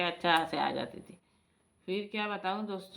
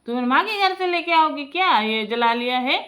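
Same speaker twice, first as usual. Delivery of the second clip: high, loud imitated telling-off; acted-out miserly father-in-law's outburst; scolding old man; 8 semitones higher, 5 dB louder than usual. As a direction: scold the daughter-in-law, pitched right up. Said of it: "ऐसे बोलता था दोस्तों".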